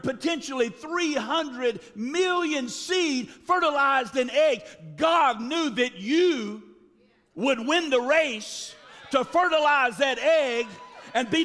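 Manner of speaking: high, loud preaching build; climbing sermon shout; full-force preaching. Acted. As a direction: preach it out to the congregation.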